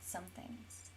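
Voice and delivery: whispered echo of "Some things"; teasing whisper. Real, not acted. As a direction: breathe it back like a secret. Echoing partner's evasive answer.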